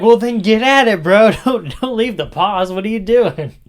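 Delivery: loud high impatient jab; hammed-up frustrated host; mock impatient host. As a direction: push them to hurry up. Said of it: "It's like".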